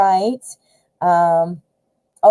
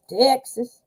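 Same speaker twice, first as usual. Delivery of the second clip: "Texas" drawn out in a mock Texas drawl; playful Texan imitation on "Texas"; Texas accent; 3 semitones higher than usual. Shown as a demonstration. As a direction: say "Texas" with an exaggerated country twang. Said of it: "like, country sounding".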